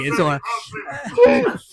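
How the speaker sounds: high, slow, hammed-up sob of a line; mock sobbing. Host pretending to be hurt about Caroline, mock sob. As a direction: fake a tearful brave face.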